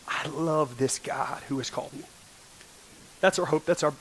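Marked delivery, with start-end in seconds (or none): 0.1-2.0 much softer, sincere imagined thought; sincere inner voice. Retold or imitated. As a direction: say it softly like a heartfelt confession. Voicing a student's heartfelt thought.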